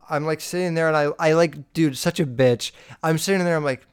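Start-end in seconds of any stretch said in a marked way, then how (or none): none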